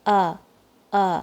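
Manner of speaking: sharp cut-off glottal stop from the bottom of the throat; abrupt stopped aa; hamza glottal stop. Shown as a demonstration. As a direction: catch the air and cut the sound dead. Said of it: "اور اس کی آواز ہے".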